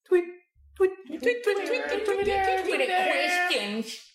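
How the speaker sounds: very high, rapid-fire bird chirps; squeaky mock birdsong jingle; bird tweeting. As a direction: tweet like an excited little bird. Bird-call jingle introduces Twitter segment.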